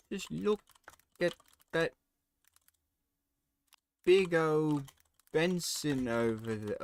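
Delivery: high, hammed-up fake British accent; cartoon British slang voice; mock British. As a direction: play a silly tourist Brit. Rehearsing British caption voice.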